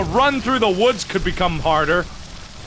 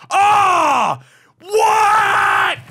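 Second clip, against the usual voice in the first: high scream into disbelief; shocked yelling; 12 semitones higher, 4 dB louder than usual. Real, not acted. Player killed by something unexpected, shocked yelling. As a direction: shriek it in shock. Yell after failing.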